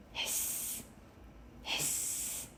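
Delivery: soft steamy hiss, no voice; kettle hiss. A narrator's reading. Tea kettle whistling, a soft breathy hiss. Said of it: "The tea kettle whistled".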